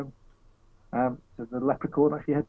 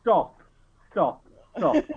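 stop repeated; 6 semitones higher, 4 dB louder, slower than usual. Real, not acted. Begs others to stop.

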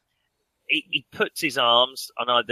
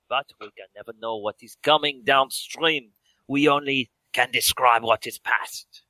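put-on hammy French-accented lecturer; French accent, deliberate and theatrical. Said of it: "made myself laugh with my accent".

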